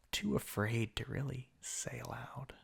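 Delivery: whispered, close and cold; menacing whisper. Acted. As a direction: breathe it like a threat. Villain hissing at the hero.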